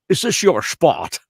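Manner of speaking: retold Connery voice at the parking spot; Sean Connery. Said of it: "Oh, Mister Connery".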